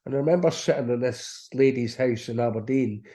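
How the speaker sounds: usual speaking voice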